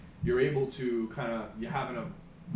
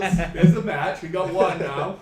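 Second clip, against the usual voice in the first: excited; 6 semitones higher, 14 dB louder, slower than usual. Real, not acted. Excited after match challenge.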